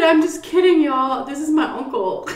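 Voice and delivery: high, loud reveal breaking into laughter; laughing confession. Retold or imitated. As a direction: confess the prank while cracking up. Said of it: "busted up laughing".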